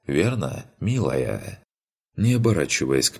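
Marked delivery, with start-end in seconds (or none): none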